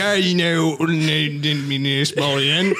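garbled, hammed-up Cockney; Benedict Cumberbatch. Confronting Sophie, barely intelligible fake Cockney. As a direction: mangle it into incomprehensible mock Cockney. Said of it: "I can't understand your voice".

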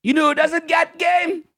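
high, hammed-up Brooklyn voice; brash Brooklyn comic delivering a punchline; Andrew Dice Clay. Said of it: "everybody from Brooklyn sounds like Andrew Dice Clay".

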